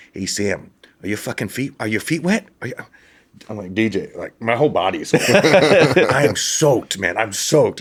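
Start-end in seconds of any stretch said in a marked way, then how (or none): 0.1-2.7 put-on light Minnesota accent, rising toward the end; buddy's Minnesota accent, lightly imitated. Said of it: "He has a heavy Minnesota accent". 3.7-7.8 laughing through the words; exasperated laugh, half pleading. Retold or imitated. Answering buddy, soaked and laughing.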